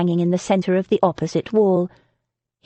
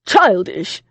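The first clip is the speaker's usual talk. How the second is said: loud outraged sputter; sputtering outrage; 4 dB louder than usual. A narrator's reading. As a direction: spit the word back in disbelief. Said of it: "Lord Breckenridge sputtered, outraged".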